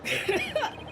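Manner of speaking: over-the-top weepy confession; hammed-up sobbing. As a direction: wail it out like a detective falling apart. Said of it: "stop your crying".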